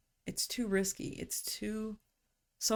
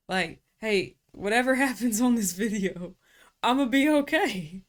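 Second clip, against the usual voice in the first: higher, louder, giggly reassurance; sing-song laughing self-pep talk; laughing playfully to herself, whatever happens; laughing mock bravado; 3 semitones higher, 8 dB louder than usual.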